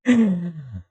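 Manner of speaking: high laugh falling in pitch. Real, not acted. Streamer amid technical mishaps, high falling laugh. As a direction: start high and let the laugh slide down.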